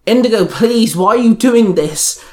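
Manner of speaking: high-pitched, desperate plea; mild scream. A narrator's reading. Violet demanding reasons from the sister, mildly screaming. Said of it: "Violet screamed".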